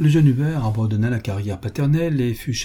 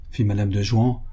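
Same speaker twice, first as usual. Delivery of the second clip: ordinary delivery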